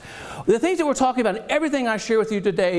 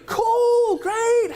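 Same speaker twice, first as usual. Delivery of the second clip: higher mock-gushing approval; mock enthusiasm; 13 semitones higher than usual. Real, not acted. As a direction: gush over it playfully.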